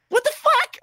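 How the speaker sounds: very high squealed outburst; loud falsetto shock, hammed up; utter disbelief. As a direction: re-enact being totally flabbergasted. Acted disbelief at other player's forgiveness.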